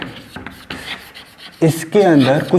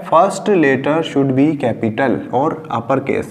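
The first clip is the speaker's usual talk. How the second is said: ordinary talk, nothing marked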